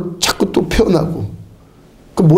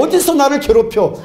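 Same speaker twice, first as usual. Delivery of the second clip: higher, half-laughing indignation; indignant mock rebuke; 6 semitones higher than usual. Shown as a demonstration. Preacher showing the flock how to scold a demon, laughing mock indignation. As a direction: act outraged, with a laugh under it. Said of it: "이렇게 화를 팍 내니까요".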